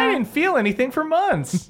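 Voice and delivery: put-on very high kid voice; squeaky youngster announcing proudly; dorky teen twin. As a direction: blurt it out like an excitable kid. Kid twin keeps talking.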